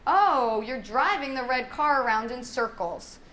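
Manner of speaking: big "Oh", then lilting description; play-by-play for a child. Shown as a demonstration. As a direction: comment on a child's play with keen interest. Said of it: "give them the play-by-play".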